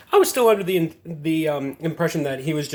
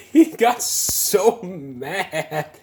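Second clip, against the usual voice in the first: high, drawn-out words through laughter; can barely get it out for laughing; cracking up; 3 semitones higher, much slower than usual. Real, not acted. Gamer recounting how mad the toxic player got, cracking up. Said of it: "that's just funny how angry he got".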